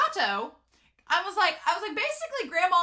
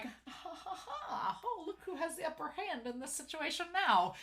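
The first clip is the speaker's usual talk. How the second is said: high, gloating mock laugh into words; smug; 8 semitones higher, 5 dB quieter than usual. Coach relishing the upper hand, smug. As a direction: play it smug and self-satisfied, pitched up. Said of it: "I love that smug look".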